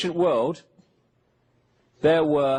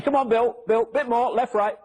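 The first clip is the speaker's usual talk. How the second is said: lightly acted, higher-pitched calls to a workmate; imagined workman directing; 6 semitones higher than usual. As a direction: call out directions as if guiding a heavy load. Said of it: "you imagine it".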